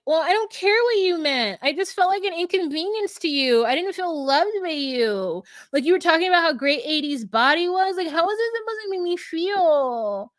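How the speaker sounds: high, whiny mock hurt; Chelsea complaining. Chelsea telling Jimmy she felt unloved, exaggerated whining. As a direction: imitate a wailing complaint, stretching the last words. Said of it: "starts to complain about".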